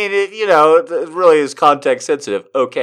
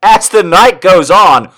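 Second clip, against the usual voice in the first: loud, high mock-dramatic narration; hammed-up loud reading; 7 semitones higher, 8 dB louder than usual. Reader launching the night's story, loud and theatrical. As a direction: belt out the story line.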